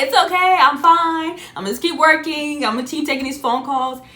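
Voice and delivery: high, loud, brittle insisting she's fine; forced cheer. Retold or imitated. Telling herself at work she's fine, in forced high cheer. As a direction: fake brightness to cover tears.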